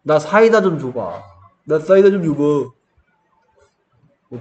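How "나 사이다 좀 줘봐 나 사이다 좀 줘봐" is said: put-on loud lisping kid. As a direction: lisp the s of 사이다. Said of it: "이렇게 하는 애들 있다니까". (혀 짧은 소리)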